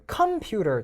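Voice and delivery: 컴퓨터 with the first syllable 컴 strong; stress on 컴. Shown as a demonstration. Teacher lists possible stress places, stress on 컴. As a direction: punch 컴, let 퓨터 follow lightly. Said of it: "세 군데가 있다는 거예요".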